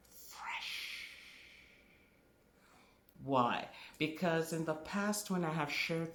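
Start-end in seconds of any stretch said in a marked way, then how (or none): 0.1-1.1 "Fresh" in a drawn-out stage whisper; emphatic whisper. Shown as a demonstration. Teacher repeating the key word to the class, whispered emphasis. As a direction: whisper "Fresh" slowly to drive it home. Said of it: "I'm emphasizing fresh".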